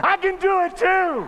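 high, drawn-out excited yell; mock-childlike boast at full pitch; triumphant shout. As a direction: crow it loudly to the whole room. Ran over, exclaiming in triumph.

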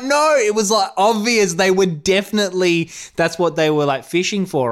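exasperated insistence in a retold argument; insistent protest. Retold argument, insisting against partner.

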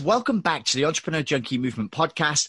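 podcast host intro. Acted. Host opens the show.

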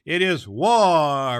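loud, slow proclamation, retaken; declaring war. A narrator's reading. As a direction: boom the words out slowly.

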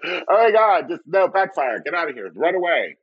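high-pitched comic alarm; mock panic. Prankster caught faking a Southern accent, mock panic. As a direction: call off the game in hammed-up panic.

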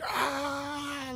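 imitated drawn-out cry, held back; retold wail. Intern overwhelmed on the rooftop, retold wail. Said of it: "crying and, and sobbing and yelling".

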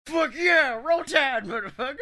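high, loud fan cheer; excited cheer. Retold or imitated. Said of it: "at which point I'm like".

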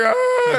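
high pained yelp; short high wail of a hurt toddler; kid's cry. Retold or imitated. Son landing in urine, high yelp. Kid crying out after falling.

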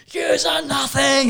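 high, drawn-out mock shout; drunken yelling. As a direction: holler it slowly like a drunk heckler. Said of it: "yelling their heads off".

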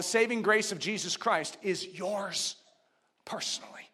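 dropped almost to a whisper; hushed. Real, not acted. Preacher making grace personal to each listener, hushed. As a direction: say it very softly, intimately. Hushed take before loud repeat.

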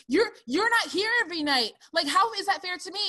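whine-yell it like an aggrieved daughter; imitated teen yelling. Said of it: "Maggie yells at her mother".

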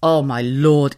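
ordinary talk, nothing marked

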